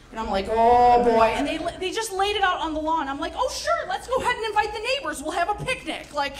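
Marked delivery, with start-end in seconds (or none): 0.5-1.3 put-on loud, drawn-out oh boy; long, loud sigh of dismay; dismayed groan. Dismayed reaction in a story. 3.3-6.1 high, sarcastic cheeriness; fake-chipper, pitched way up; mock enthusiasm. Sarcastic reaction to flag on lawn.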